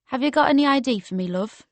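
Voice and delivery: a hint of Geordie, rattled off fast; Geordie accent. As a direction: bouncer asking for ID, in a light Geordie accent. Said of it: "in a strong Geordie accent".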